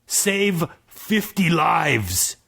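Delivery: very slow dim giant voice. Acted. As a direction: plod each word like a dumb giant. Giant speaks.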